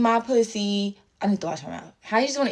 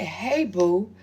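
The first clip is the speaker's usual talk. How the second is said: mocking read-out; 3 semitones lower, 3 dB quieter than usual. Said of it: "Then she said".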